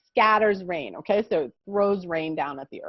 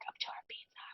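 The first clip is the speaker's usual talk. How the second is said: whisper; 27 dB quieter than usual. Real, not acted. Aside while fixing slides.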